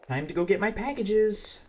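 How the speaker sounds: sing-song. Acted.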